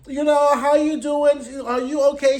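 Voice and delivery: high, sugary fake concern; mock sweet Serena. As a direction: put on a syrupy, overly nice voice. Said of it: "Serena would be like".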